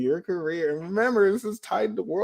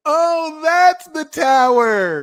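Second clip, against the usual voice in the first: high, loud, slow "aha"; excited realization; 11 semitones higher, 9 dB louder, much slower than usual. Retold or imitated. Realizing the beer run was the tower card, high excited exclaim. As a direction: land the realization with delight.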